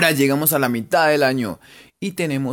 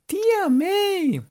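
very high drawn-out call; mock cry to an aunt, pitched way up; much slower than usual. Calling out Spider-Man's aunt.

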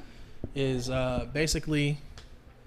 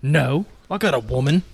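louder street British reply; street British accent; 5 dB louder than usual. Acted. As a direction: answer as a street British tough guy. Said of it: "in the street British accent".

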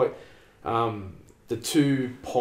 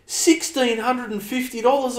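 shocked dad; 12 semitones higher, 3 dB louder than usual. Retold or imitated. Dad shocked at wheel price.

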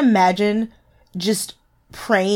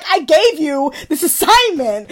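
high, loud, drawn-out scolding; comic exasperation, stretched out; mock-exasperated therapist; 7 semitones higher, 5 dB louder, slower than usual. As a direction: imitate a therapist scolding a client. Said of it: "she's like".

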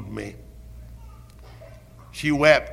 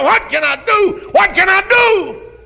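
much higher, much louder desperate shout, the second slower; anguished cry; 10 dB louder than usual. Retold or imitated. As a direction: scream it out in despair. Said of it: "wring your hands and cry".

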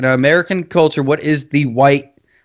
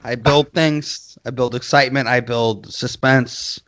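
slow, lower, dramatic build; slow suspense build; 3 semitones lower, 3 dB quieter than usual. Shown as a demonstration. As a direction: draw it out like a horror trailer. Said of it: "I'm a builder".

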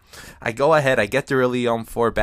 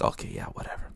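whispered brush-off; 13 dB quieter, faster than usual. Retold or imitated. Muttered reply to threat.

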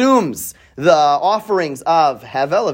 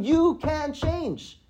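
soft, slow, higher reassurance; gentle God voice; 10 semitones higher, 9 dB quieter, much slower than usual. Retold or imitated. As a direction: tenderly tell Cain he can change. God's gentle reply to Cain.

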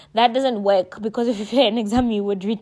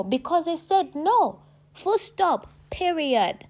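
emphatic insistence; 7 semitones higher, slower than usual. Real, not acted. Insisting on her no.

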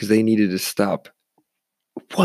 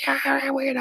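very high squealed imitation; helpless high-pitched cackle of agreement; mock laughing reply; 4 dB quieter than usual. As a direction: imitate giddy drunks, words dissolving into a squeal. Laughing group being kicked out.

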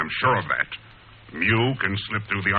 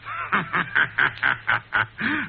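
villain laugh; 9 semitones higher than usual. Acted. Villain gloating alone.

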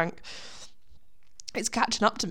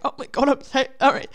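higher, hammed-up fluster; mock-panicked apology; 4 semitones higher, 4 dB louder than usual. Worker replaying her mistake in her head, mock-panicked. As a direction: exaggerate a panicked inner apology.

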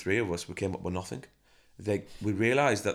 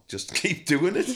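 laughing; 11 semitones higher, 5 dB louder, slower than usual. Real, not acted. Laughs at own story.

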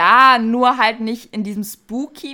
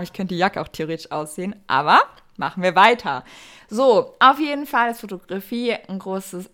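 ordinary delivery

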